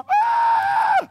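high shout of excitement in the car; hammed-up scream of joy; excited scream. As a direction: yell it out, thrilled.

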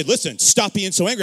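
loud, high-pitched retold reprimand; exasperated scolding. Family member telling the child to calm down, exasperated. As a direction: scold over the top of the denial.

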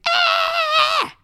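put-on piercing girlish shriek; long, loud storybook scream; high-pitched scream. A narrator's reading. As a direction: shriek at the top of the voice. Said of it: "Margaret screamed".

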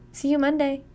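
a bright call from the car window. A narrator's reading. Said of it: "Alexis calls".

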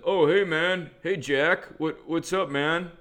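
hint of a meathead bro; bro voice impression. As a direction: greet a buddy like a bro. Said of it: "I'll do my impression in a second".

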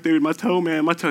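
a touch of a high pained whine; mock whine. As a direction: keep pointing at the toe, half-hammed. Retelling own pain, insisting.

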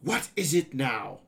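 impatient interruption; mildly irritated cut-in; interrupting. A narrator's reading. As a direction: cut in, a touch exasperated. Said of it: "I interrupted".

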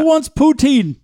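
put-on high, loud Canadian accent. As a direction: call out like a cheery Canadian. Mock Canadian stereotype voice.

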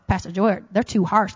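mock a grumbling listener; a hint of a grumbling churchgoer; whining congregant. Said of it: "That's 'cause we love y'all".

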